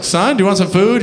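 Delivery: high-pitched mom calling to her son. Acted. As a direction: play a mother offering food.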